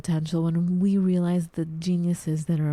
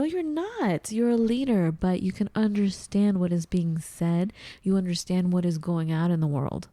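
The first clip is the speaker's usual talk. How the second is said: ordinary delivery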